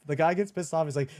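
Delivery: ordinary delivery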